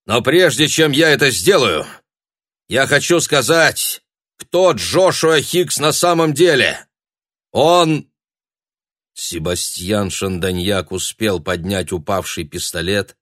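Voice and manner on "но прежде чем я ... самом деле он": выше и громче, с нажимом; тянет паузу перед разоблачением; declaiming. A narrator's reading. Обличительная речь перед залом. (торжественное обличение)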